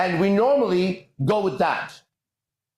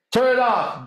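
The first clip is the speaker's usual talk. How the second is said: stern dad; 6 semitones higher, slower than usual. Retold or imitated. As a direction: bark it like a fed-up father. Dad and kid argue over song.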